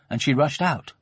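usual speaking voice

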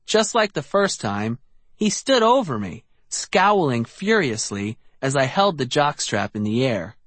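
ordinary delivery

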